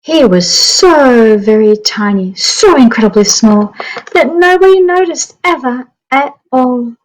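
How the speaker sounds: lively storybook narration for a class; reading to children. Acted. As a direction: read it like story time on the carpet. Reading picture book aloud.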